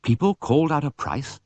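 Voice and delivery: ordinary delivery, nothing marked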